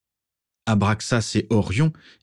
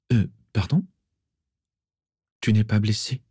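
bewildered, hesitant question; stunned surprise; 2 semitones higher than usual. A narrator's reading. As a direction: ask it stunned. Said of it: "Stupéfaite".

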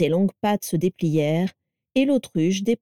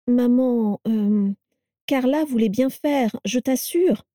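higher, hesitant placating; stammering; 5 semitones higher, slower than usual. A narrator's reading. Edgar defends his wife to his mother, stammering. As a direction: falter on an "euh", then plead in a raised pitch. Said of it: "bafouilla-t-il".

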